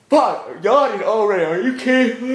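high, loud exasperated yelling; frustrated outburst. Real, not acted. Vlogger to girlfriend, fed up with yawning, loud and high. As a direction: blow up at the yawning.

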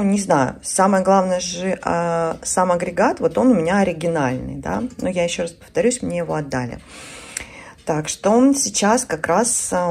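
normal talking voice

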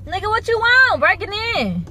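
high, loud mock challenge; playfully yelled, high-pitched challenge; mock confrontation. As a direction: shout at a pretend car thief. Pretend car break-in challenge.

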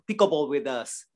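usual speaking voice

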